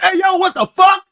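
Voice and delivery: high, loud mock shout of the sound effect; imitating background voice. Repeating the clip's sound effect, high mock yell. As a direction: squeak it out like a cartoon hype man.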